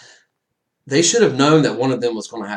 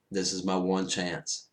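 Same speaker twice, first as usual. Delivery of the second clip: soft inner resolve; 4 semitones lower, 9 dB quieter than usual. Retold or imitated. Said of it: "he's like".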